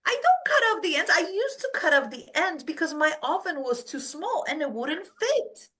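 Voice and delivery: put-on high, emphatic old woman setting it straight; lightly voiced great grandma, high; great grandma explaining. Great grandma explaining the ham to her granddaughter, high.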